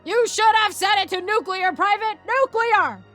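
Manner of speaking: loud, high drill-sergeant yelling; military shouting. Acted. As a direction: bellow it like a sergeant giving orders. Sergeant and private bark in story.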